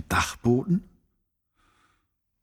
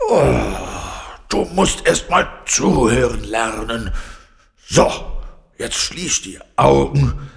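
loud, groaning lion voice; exasperated lion; 6 dB louder than usual. A narrator's reading. Lion tells noisy Carla to listen, exasperated. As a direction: scold loudly like a worn-out lion.

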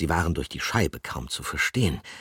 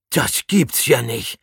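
higher, louder, slow disbelieving exclamation; incredulous cry; 7 semitones higher, 6 dB louder, slower than usual. A narrator's reading. Pomme de Terre can't believe the rats are there, loud astonished cry. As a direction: rub your eyes and cry out. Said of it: "rief er".

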